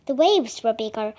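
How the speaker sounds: plain reading voice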